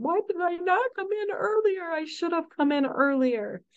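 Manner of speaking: high, tearful imitation of a regretful parent; voicing tearful families. Nurse quoting families who leave in tears, high tearful voice. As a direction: lift the voice into a mom's tearful regret.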